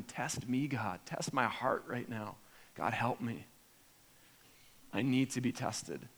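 quiet prayer. Retold or imitated. Believer asking God to test him, softly. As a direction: pray it under your breath, humbly. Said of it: "I have to ask him".

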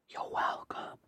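breathy whispered purr; a teasing, breathy whisper; breathy vintage purr. Acted. Whispered aside after joking boast.

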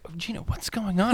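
hushed, alarmed whisper to his wife; a retold hushed question; whispered aside. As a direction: lean in and whisper the worry.

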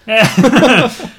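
a sudden bright burst of laughter; a real laugh at the joke; loud laugh. Real, not acted. Laughs at Jay's answer.

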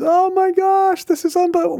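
high, slow amazed oh my gosh; amazed friend. Retold or imitated. Friend reading the emailed quote aloud, amazed. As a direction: gasp it out in amazement.

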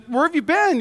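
mock questioning wife. Wife confronts late husband in retold story, questioning. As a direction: imitate a wife demanding answers.

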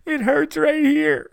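mocking a shirtless fan weeping over his team, "it hurts right here" drawn out high. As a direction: ham up a sobbing fan saying "it hurts right here" slowly. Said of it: "he's crying, he's weeping".

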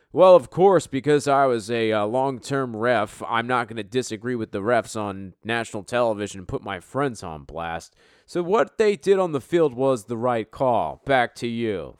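mock pundit defending the refs; mock referee voice. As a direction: play the spineless ex-ref defending his friends. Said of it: "I'll be the referee".

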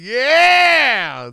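high, loud, stretched yeah; hammed-up imitated shout; mock villain yell. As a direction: holler it like the movie villain. Re-enacting the villain's cry.

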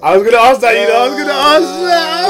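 excited. Real, not acted. Group teasing, overlapping.

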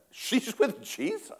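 high happy proclaiming; joyful exclaim. Retold or imitated. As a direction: sing out the good news. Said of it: "we went".

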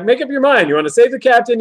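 high, exasperated challenge; playfully fed-up demand; mock exasperation. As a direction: scold a wavering colleague, pitched right up. Acts exasperation at Uhura's change.

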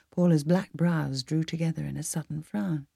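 ordinary delivery, nothing marked

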